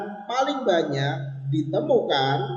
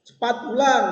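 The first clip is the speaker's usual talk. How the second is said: high, louder exclaimed command; high intonation; 8 semitones higher, 5 dB louder than usual. Shown as a demonstration. Teacher demonstrates a verbal command, high intonation. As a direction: snap out an order in a raised pitch. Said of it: "terdengar tinggi".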